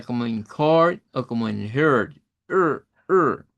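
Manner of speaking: court and heard with a strong American r, then er, er held; r-colored vowel demo. Shown as a demonstration. Teacher demonstrating the rhotic er sound to students. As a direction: curl the tongue back on Er, er. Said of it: "el sonido roticidad".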